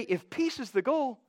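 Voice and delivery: lightly voiced know-it-all counsel; a hint of smug worldly reasoning; worldly wisdom voice. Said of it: "Worldly wisdom would say".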